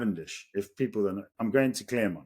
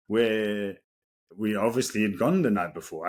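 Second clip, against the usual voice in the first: ordinary talk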